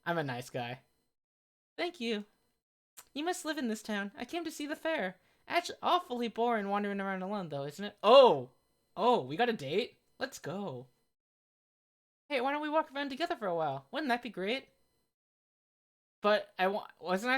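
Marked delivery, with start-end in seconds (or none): 1.8-8.0 high-pitched heroine making small talk; friendly girl character. Acted. Voices girl in game. 12.3-14.7 high, quick heroine voice; eager girl character. Acted.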